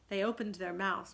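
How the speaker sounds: usual speaking voice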